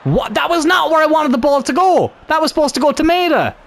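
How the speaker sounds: real exasperated shout; frustrated shout. Real, not acted. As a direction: yell in disbelief at the screen. Shock at misplaced pass.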